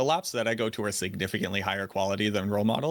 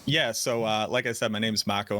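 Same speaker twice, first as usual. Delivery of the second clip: ordinary talk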